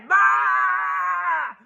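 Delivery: high shouted bah; mock scream. Possum screaming while fleeing up the tree. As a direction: yelp up the tree.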